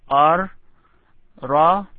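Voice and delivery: R and Ra loud and isolated; emphatic letter demo. Shown as a demonstration. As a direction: punch out R, then Ra, as separate model sounds. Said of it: "I would like you to pronounce".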